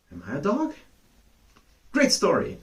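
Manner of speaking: ordinary delivery, nothing marked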